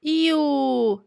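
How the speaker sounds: grossed-out high squeal; disgusted. Real, not acted. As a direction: recoil in disgust. Reaction to dislocated finger fact.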